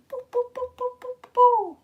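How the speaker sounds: tiny, very high "boo" sounds; high-pitched booing noises. Shown as a demonstration. Answering what a flea says, high silly boo noises. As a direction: squeak out a string of little boos. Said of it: "supposed to be said like".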